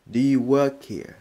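emphatic demo. Shown as a demonstration. Teacher drilling the full question loudly. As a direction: project the whole question clearly.

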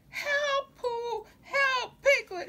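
high, loud cry for help; frightened shout, high and loud. A narrator's reading. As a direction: yell out to friends in panic. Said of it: "he shouted".

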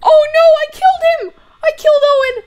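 high, loud wail of alarm; panicked cry. Real, not acted. Player realizing he killed his teammate Owen, panicked high cry. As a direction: cry out in dismay at your own blunder.

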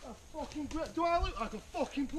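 swearing tirade. Real, not acted. Angry stranger confronting players.

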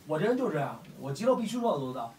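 ordinary talk, nothing marked

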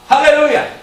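loud, high cry of exultation; praise shout. Real, not acted. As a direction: shout it out to rouse the congregation. Preacher cries out praise to congregation.

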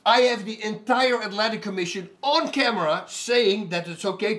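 retold furious telling-off, shouted high and loud; heated rant. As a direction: tear into the IFL guy at full volume. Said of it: "I go".

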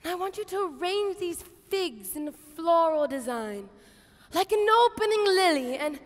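normal talking voice

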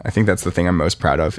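usual speaking voice

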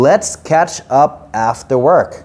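"Let's catch up after work" stretched out very slowly; unhurried teacher demo of the sentence; slow model sentence. Shown as a demonstration. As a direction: model the line slowly for learners. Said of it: "รวมประโยคครับ".

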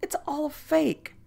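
a high, slurring showman leering as he confides; drunken leer. Acted. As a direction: sound tipsy and sly, letting him in on a secret. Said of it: "he leered drunkenly".

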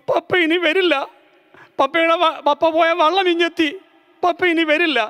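put-on high, tearful child calling her mother; crying child. A narrator's reading. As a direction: cry out that father is gone. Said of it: "കരയുന്നത് കണ്ടപ്പോഴേക്കും".

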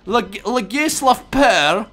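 hammed-up English accent. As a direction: stretch out Legislav Pear like an Englishman. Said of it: "já už mluvím v anglickém přízvuku".

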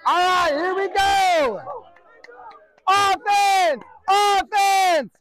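real high-pitched field yell; rousing coach's shout. Real, not acted. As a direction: holler to fire up the players. Coach calling players into drill.

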